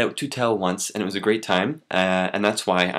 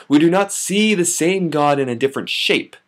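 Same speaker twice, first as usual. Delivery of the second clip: higher, emphatic declamation; slam poem; 8 semitones higher, 6 dB louder than usual. Acted. Poet addressing audience between refrains, emphatic slam. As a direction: proclaim it over the room. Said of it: "I'm gonna slam it for you".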